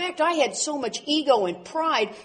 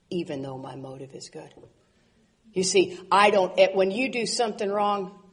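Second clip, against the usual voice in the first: ordinary talk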